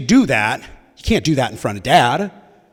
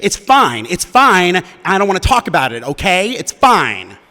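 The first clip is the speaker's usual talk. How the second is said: worked-up, higher-pitched defensive rant; retold angry outburst; 5 semitones higher than usual. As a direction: act out snapping and insisting it's fine. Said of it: "I started shouting".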